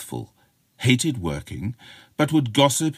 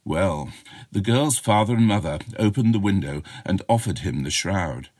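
ordinary delivery, nothing marked

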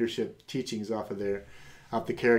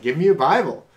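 eager demand; 5 semitones higher, 10 dB louder than usual. Retold or imitated. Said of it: "I'm like".

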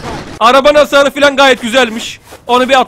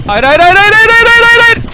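rising higher and louder panicked hayır; 12 semitones higher, 7 dB louder than usual. Real, not acted. Pilot panicking as his helicopter crashes, a rising frantic no. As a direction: cry out hayır as the helicopter falls.

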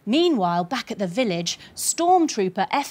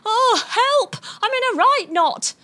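mock cry for help; 9 semitones higher than usual. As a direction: squeal it like you're stuck. Poe cries out tied up.

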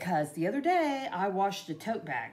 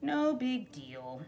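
slow, much softer, breezy; shrugging it off; 6 semitones lower, 8 dB quieter, slower than usual. Retold or imitated. Deciding not to fetch the sharpening stone, breezily dismissive. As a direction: brush it off under your breath.

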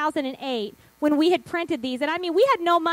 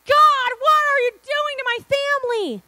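high-pitched mock despair to heaven; imitated exasperated plea; 8 semitones higher than usual. Wife imitating her husband while all are crying, exasperated plea. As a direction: plead upward, as if at wits' end.

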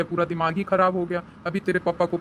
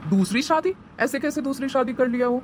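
high, shocked disbelief; mother can't believe her ears; 6 semitones higher than usual. Acted. Mother hears of second marriage. (दूसरी शादी सुनकर माँ की हैरानी भरी ऊँची आवाज़)

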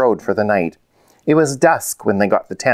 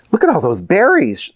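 high-pitched, mildly raised cry of discovery; excited shout; 8 semitones higher than usual. A narrator's reading. Rosie spotting berries by the camp, excited mild shout. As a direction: call out a find, pitched up. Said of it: "Rosie shouted".

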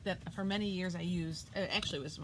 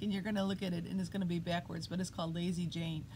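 usual speaking voice

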